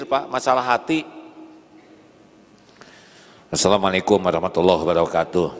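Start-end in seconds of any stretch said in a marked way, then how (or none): 3.5-5.5 rattled off fast without a smile; unsmiling rushed greeting. Shown as a demonstration. Preacher shows a greeting with no smile, hurried. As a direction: toss the greeting off quickly and coldly. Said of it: "Senyum tidak nih saya".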